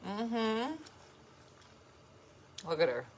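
ordinary delivery

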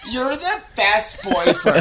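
high, drawn-out girlfriend imitation; mock gushing girlfriend. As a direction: gush it slowly in a high, put-on voice. Girlfriend gushing while side-hugging him.

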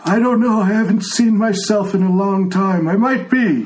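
put-on a frail old man who can't hear; old blind tiefling. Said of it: "he says".

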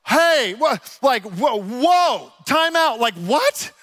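retold astonishment, called out; stunned disbelief. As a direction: stop the story in shock. Stunned by the conversion story.

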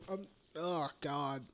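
slow, soft weary mumble; worn-out sigh of words; tired. Real, not acted. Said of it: "I'm so tired".

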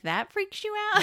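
high, loud incredulous squeal; mock disbelief. Podcaster mocking characters who flee the house, incredulous. Mocks characters fleeing in fright.

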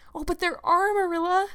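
put-on high, insistent girlish protest; eager cry. A narrator's reading. Anne contradicts Marilla about ghosts, eagerly. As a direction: burst out eagerly, pitch way up. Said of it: "cried Anne eagerly".

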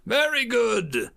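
high, slow commendation; approving instructor. A narrator's reading. Educator rewarding a cadet in the dark, high slow approval. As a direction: draw the praise out, pitched high.

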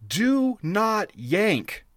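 slow, drawn-out mock yell; retold scream. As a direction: call it out long and stern like an instructor. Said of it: "all I hear is someone screaming".

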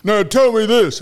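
loud, high-pitched mock grumpy voice; imitating Don. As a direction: demand it loudly, mimicking a grumpy man. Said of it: "it's Don".

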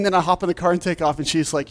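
ordinary delivery

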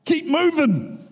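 an anxious father hustling Jesus along; impatient hurry-up. Pretend. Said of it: "I'd be like".

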